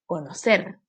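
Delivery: "Conocer" as co-no-CER; "Conocer" with weight on the final syllable; stress on last syllable. Shown as a demonstration. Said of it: "última sílaba".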